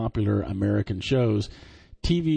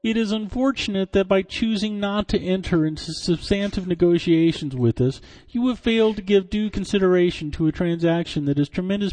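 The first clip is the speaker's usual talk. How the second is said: higher, nasal-free mock CEO read; a hammed-up imitation reading the letter; channeling Bill Gates; 7 semitones higher than usual. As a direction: pitch the letter up like a geeky tech founder. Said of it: "inexplicably channeling Bill Gates".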